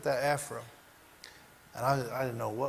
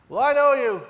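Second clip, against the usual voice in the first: firm insistence; 9 semitones higher, 11 dB louder than usual. Retold or imitated. Coach insisting to patient.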